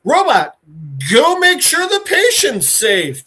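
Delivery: mock-urgent loud order; calling out an order. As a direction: call the robot over, slowing down. Orderlies call out to robot.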